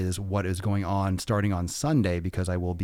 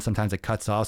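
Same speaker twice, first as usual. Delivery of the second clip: ordinary delivery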